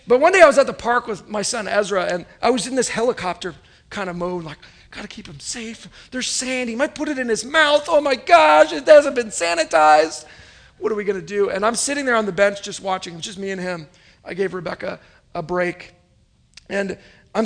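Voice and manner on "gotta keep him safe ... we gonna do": mock panic. Helicopter dad panicking over sand.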